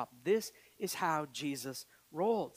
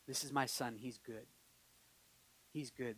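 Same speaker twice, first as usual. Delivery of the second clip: low, very soft, tender statement; softly voiced God; hushed divine approval; 8 semitones lower, 10 dB quieter than usual. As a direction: speak it quietly, like a proud father. Voicing God speaking of Jesus.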